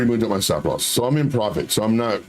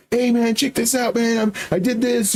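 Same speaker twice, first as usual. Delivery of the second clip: high, excited bragging to friends; acted-out show-off excitement; mock hollering; 10 semitones higher than usual. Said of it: "you're not screaming, you're not hollering".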